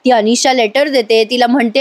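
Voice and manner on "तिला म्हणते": usual speaking voice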